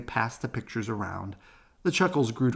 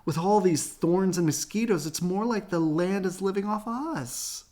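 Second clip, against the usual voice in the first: Mama shouting; 8 semitones higher than usual. Acted. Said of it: "shouted Mama".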